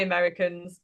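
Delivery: ordinary talk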